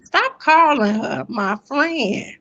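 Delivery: squeaky, high-pitched indignant denial; high-pitched protest. Real, not acted. Protesting to the host that Kimmy isn't her friend, squeaky and high. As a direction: shoot your voice way up to deny it.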